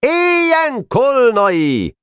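high, long-drawn hurrah; enthusiastic cheer. A narrator's reading. Boys hailing their new president, enthusiastic high cheer. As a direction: cheer the name out slowly and high. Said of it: "lelkesen kiáltották az új elnök nevét".

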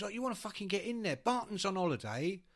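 high, urgent Cockney patter; pushy Cockney phone caller; Cockney lunatic. Acted. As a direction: badger them in broad Cockney. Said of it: "this kind of Cockney lunatic".

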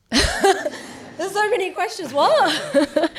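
high, slow, laughing through the words. Real, not acted. Answering the host's many questions, laughing.

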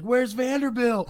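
high-pitched mocking holler; imitated yell. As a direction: call it out like heckling from a car window. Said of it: "Calvin was yelling at him out the window".

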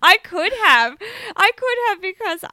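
high, loud, through laughter; real laughing. Real, not acted. Host owning up to a slip, laughing. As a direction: giggle helplessly while admitting it.

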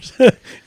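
sudden high, loud laugh; big real laugh; loud burst of laughter. Real, not acted.

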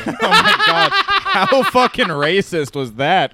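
loud slow laughing disbelief. Real, not acted. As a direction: laugh out the outrage. Laughing at racist game.

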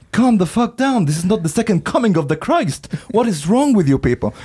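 hammed-up telling-off of the zealots; mock exasperation. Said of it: "And it's like".

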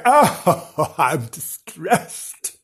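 hammed-up distressed wail, drawn out slowly; exaggerated ghostly lady in distress; mock distressed. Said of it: "She was laying there go".

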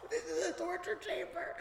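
high, squeaky tearful whimper; mock sobbing. Storyteller trapped on the ride, high mock-crying whimper. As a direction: squeeze the words out through fake sobs. Sobbing rider in retold panic.

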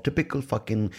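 ordinary talk, nothing marked

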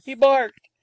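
put-on high, loud child's voice; small boy. A narrator's reading. Small boy to the nurse about the dog, high and excited. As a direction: pipe it up like an eager small boy.

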